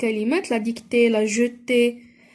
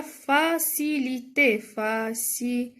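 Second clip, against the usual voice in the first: "فاسيليتي" split into separate, drawn-out syllables; each syllable of "فاسيليتي" held apart; syllable-by-syllable sounding out; much slower than usual. Shown as a demonstration. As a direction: sound out "فاسيليتي" slowly for learners. Sounds out the new noun.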